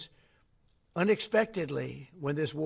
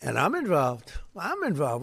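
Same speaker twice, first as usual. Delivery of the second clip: ordinary talk, nothing marked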